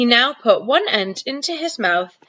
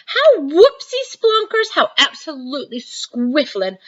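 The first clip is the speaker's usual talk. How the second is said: overjoyed; 7 semitones higher than usual. Acted. Giant thrilled by Sophie's praise, giddy delight. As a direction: bubble over with joy. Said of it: "still beaming".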